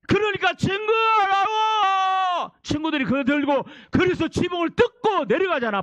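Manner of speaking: high, forceful storytelling from the pulpit; impassioned preaching. Acted. Preacher retelling friends lowering the paralytic, impassioned preaching. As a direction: preach it in bursts, calling out.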